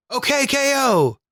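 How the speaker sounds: very high-pitched fake shout; exclaimed title yell. Shown as a demonstration. Showing co-host how the exclamation point sounds, exclaimed. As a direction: cry it out as a full exclamation. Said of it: "So it's like".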